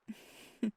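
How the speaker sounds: small breathy laugh. Acted. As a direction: let a little airy laugh slip out. After saying I love you.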